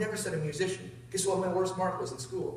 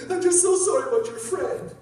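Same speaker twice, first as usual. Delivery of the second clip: high, slow, louder imitation of sobbing; mock tearful trucker; 12 semitones higher, 5 dB louder, slower than usual. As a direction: wail it out as a big man in tears. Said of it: "this big dude starts crying".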